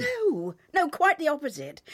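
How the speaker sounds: high, loud, hurried protest; urgent denial. Acted. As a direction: cut him off, denying it. Denying the accusation at once.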